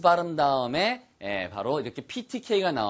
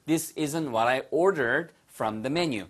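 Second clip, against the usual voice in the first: slow, clear full sentence; slow teacher demo; slow model sentence; much slower than usual. Shown as a demonstration. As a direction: lay out each word for learners.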